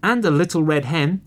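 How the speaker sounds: high, loud little fox cry; excited scream. A narrator's reading. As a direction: squeal it like eager cubs. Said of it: "screamed the other two".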